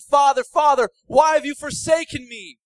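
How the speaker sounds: high, loud shout to the Father; anguished cry. Acted. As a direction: cry out as if abandoned on the cross. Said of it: "He cried out".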